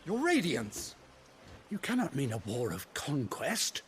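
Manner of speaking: aghast courtier, pitch jumping up; shocked protest. Acted. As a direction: cry out in alarm to your ruler. Adviser protests the ruler's plan.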